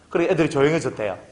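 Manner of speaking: usual speaking voice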